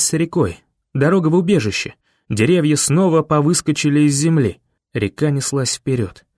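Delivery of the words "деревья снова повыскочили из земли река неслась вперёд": plain reading voice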